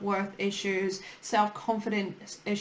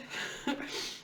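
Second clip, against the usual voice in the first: soft breathy chuckle; a quiet, kind laugh; kind gentle laugh; 8 dB quieter than usual. Real, not acted. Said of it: "I'm not laughing at you".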